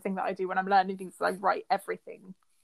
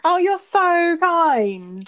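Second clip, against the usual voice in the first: higher, louder and very slow gushing thanks; mock gratitude; 8 semitones higher, 7 dB louder, much slower than usual. To a friend letting her go first, hammed-up gratitude. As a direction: stretch it out, teasing sweetness.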